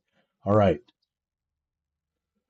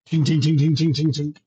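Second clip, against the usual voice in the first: imitated ticking; 4 semitones higher than usual. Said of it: "GM imitates machine noise".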